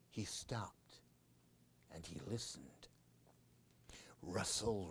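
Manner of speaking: hushed whisper; storyteller whispering before the scare; suspense whisper. A narrator's reading. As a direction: drop to a whisper to build suspense.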